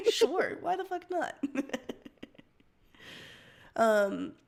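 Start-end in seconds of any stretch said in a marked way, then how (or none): 0.1-1.6 laughing. Real, not acted. Laughing at subscribe suggestion.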